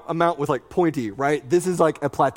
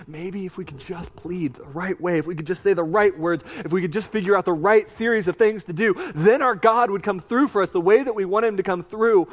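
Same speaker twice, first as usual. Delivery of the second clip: pleading; 6 semitones higher than usual. Retold or imitated. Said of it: "they're thinking".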